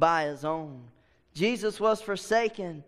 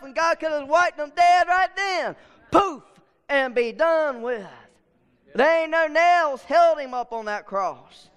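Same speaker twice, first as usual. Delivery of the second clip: louder, higher preaching that builds to a shout; full-force preaching; 7 semitones higher, 4 dB louder than usual. Real, not acted. Preacher to congregation about the cross, full-force preaching. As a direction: preach it out to the whole church.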